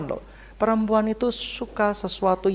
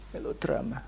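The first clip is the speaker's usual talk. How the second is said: whisper; 7 semitones lower, 14 dB quieter than usual. Acted. Whispered aside.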